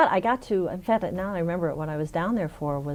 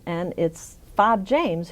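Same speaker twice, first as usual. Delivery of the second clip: normal talking voice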